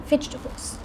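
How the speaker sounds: hushed whisper, barely voiced; very soft, voiceless aside; whispered dread. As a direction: whisper it like a dirty word. Dreaded food at end of story.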